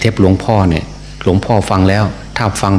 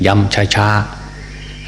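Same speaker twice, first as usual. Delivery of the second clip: ช้าๆ spoken very slowly, like a slowed tape; slowly drawn out; 4 dB quieter, much slower than usual. Shown as a demonstration. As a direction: say ช้าๆ slowly, stretching each syllable. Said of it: "ย้ำ ช้าๆ".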